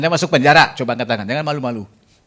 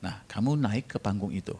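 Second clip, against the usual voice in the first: lightly voiced God, softer and hushed; 4 semitones lower, 9 dB quieter than usual. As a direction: speak as God, quietly commanding. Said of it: "suara Tuhan". (suara Tuhan yang lembut dan pelan)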